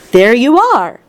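high, crowing exclamation; triumphant cry. A narrator's reading. Wonka proves his point to the visitors, triumphant. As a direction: crow that you've been proved right. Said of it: "he cried triumphantly".

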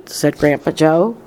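ordinary delivery

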